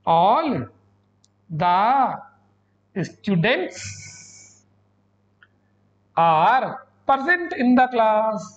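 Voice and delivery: all the students are present in the class, every word drawn out slowly; slow dictation. Shown as a demonstration. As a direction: dictate each word slowly, like writing on the board. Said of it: "देखो पता है कैसे". (हर शब्द धीरे-धीरे खींचकर बोलना)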